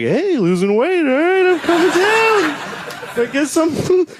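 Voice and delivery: high, excited mock cheer; fooled fat guy. Fat guy thinking his loose pants mean weight loss, high mock cheer. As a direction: cheer as if you're finally slimming. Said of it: "you're like".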